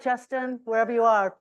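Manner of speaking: ordinary delivery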